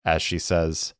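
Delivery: ordinary delivery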